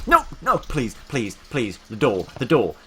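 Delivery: loud, high bursts of panic; panicked pleading. Real, not acted. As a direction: beg urgently in short separate cries. Panicked pleading during game attack.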